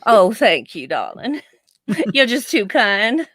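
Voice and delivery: hammed-up Southern politeness; Southern accent. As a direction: play the gracious Southerner. Answers in mock Southern politeness.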